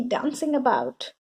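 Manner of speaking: ordinary delivery, nothing marked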